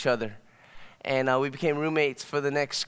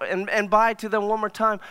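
ordinary talk

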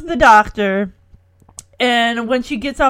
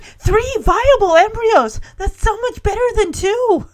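higher, mildly excited quote; retold delight; 9 semitones higher, 3 dB louder than usual. Host retelling Jimmy's reaction to three embryos, delighted. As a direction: imitate a thrilled reaction, pitched high.

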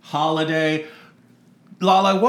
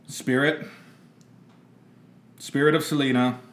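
seance summons; 4 semitones lower, 4 dB quieter than usual. Acted. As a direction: summon the spirit with long pauses. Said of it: "Speak through me".

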